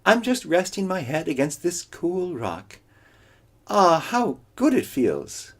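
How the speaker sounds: high lazy coyote; feigned ease. Acted. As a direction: pretend you're relaxing.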